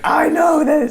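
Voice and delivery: high shouted mom impression; mock mom. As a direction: squeal it like a mom who knows best. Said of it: "So she can say, like".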